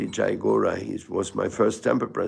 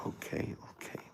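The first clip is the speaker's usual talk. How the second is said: whispered okay; 17 dB quieter than usual. Retold or imitated. Reluctantly giving in to insistent person.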